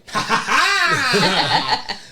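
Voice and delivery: high, loud mock laugh; imitated child's laugh. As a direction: burst out in a little kid's laugh.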